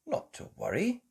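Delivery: high whispered reassurance; reassuring. A narrator's reading. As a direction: soothe gently. Mummy reassuring.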